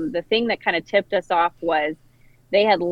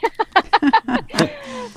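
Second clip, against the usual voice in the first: high laugh; 4 dB louder than usual. Real, not acted. Laughs off alarm while outside.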